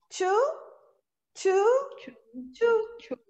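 to said full, TOO, rising; strong to. Shown as a demonstration. Teacher demonstrating strong to. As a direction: give to its full vowel. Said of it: "A pronúncia forte é essa".

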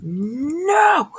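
high, sharp cry of no; panicked no. Real, not acted. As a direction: yelp as it goes wrong. Losing in game.

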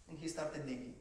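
ordinary delivery

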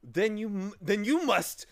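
loud, high-pitched gasp of realization. A narrator's reading. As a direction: stammer it out with a gasp. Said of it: "gasped Milo".

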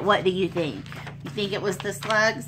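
usual speaking voice

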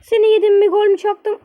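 high, loud gleeful cheer; celebrating the goal loudly; triumphant shout. Real, not acted. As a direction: crow over the goal.

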